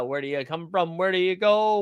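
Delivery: normal talking voice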